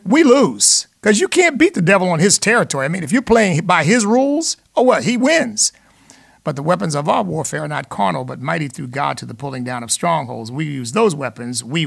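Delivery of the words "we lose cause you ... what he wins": higher and louder, driving home the point; rousing call-in host sermonizing; preaching build. Real, not acted. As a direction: raise it up like a pulpit climax.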